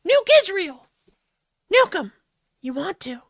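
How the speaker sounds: high, loud demon egging him on; cartoon shoulder demon. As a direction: shriek Steal the money like an impish devil. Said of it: "cartoonish demons".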